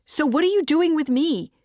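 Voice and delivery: loud, high confrontational question; heated demand. A narrator's reading. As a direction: hurl it at your partner. Narrator voices heated lovers' fight.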